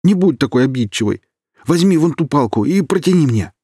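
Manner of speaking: high, loud frantic begging; frantic pleading. A narrator's reading. Kirill begging Alina to hand him the stick. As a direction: plead desperately, slowing to insist. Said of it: "взмолился Кирилл".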